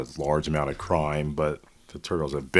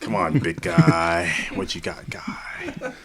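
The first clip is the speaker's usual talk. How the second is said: slow, high opening fading to a whisper; Jack Palance; 7 semitones higher, slower than usual. Pretend. Co-host joining the joke casting of Splinter, slow whispery impression. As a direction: drag it out, then trail into a breathy whisper. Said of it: "Jack Palance was the voice of Splinter".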